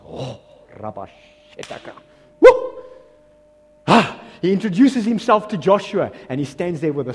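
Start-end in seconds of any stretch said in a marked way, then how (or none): 2.4-2.8 high loud woo; sudden exuberant whoop; excited whoop. Real, not acted. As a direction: let out a whoop of joy. Preacher's excited outburst.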